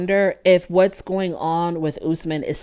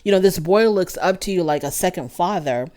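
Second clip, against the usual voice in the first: ordinary delivery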